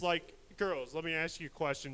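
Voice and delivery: ordinary delivery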